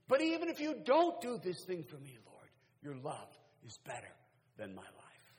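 impassioned prayer coming down gently; cry easing into surrender. Acted.

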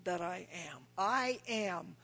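slow, ringing, each I am hammered; preaching at full force. Acted. Preacher booms God's own words to the congregation, slow and grand. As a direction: build the list like a proclamation from heaven.

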